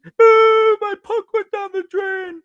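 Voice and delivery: put-on very high, slow, whimpering little-boy voice; whiny crying kid. A crying boy telling his mom about the lost puck, whiny. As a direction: whine it like a ten-year-old in tears.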